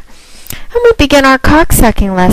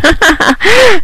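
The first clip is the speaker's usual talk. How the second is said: mocking high laugh; 11 semitones higher than usual. Real, not acted. Mocking the caller's size.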